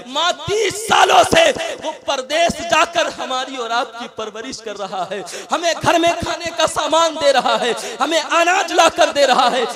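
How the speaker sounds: loud, high, fervent pleading; impassioned preaching. Acted. Son pleading with his weeping mother in loud, fervent preaching. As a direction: plead the father's sacrifice with full force.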